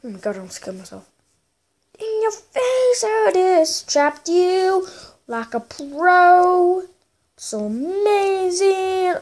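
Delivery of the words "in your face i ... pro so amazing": high, slow singsong triumph; gloating. Real, not acted. Player who just trapped a rival, slow high gloating. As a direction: draw it out high, crowing.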